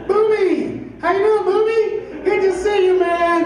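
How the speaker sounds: playful greeting. Real, not acted. Greets friend in crowd playfully.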